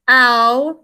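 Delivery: ordinary talk, nothing marked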